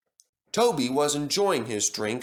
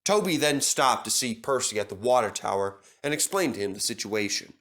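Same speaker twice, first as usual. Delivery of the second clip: ordinary delivery, nothing marked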